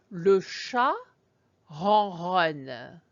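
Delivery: ronronne rolled at the back like purring; le chat ronronne with purring R sounds; purring French R. Shown as a demonstration. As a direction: purr the R's in ronronne. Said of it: "you will hear how it almost sounds like purring".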